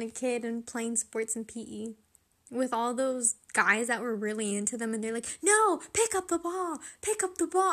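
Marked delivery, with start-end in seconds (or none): 5.4-7.7 loud, much higher mocking yell; pushy PE boys. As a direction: bark it like a jock in gym class. PE boys yelling at her.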